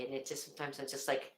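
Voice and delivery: ordinary talk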